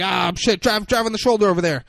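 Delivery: high, loud, hurried imitation of alarm; mock panic. Cop to driver on seeing traffic, mock panic. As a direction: blurt urgent driving orders, acted out.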